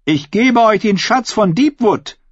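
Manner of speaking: high-pitched, forceful oratory to a hall; defiant female claimant. Acted. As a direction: challenge the crowd like a contender for the crown. Asha presents mock treasure.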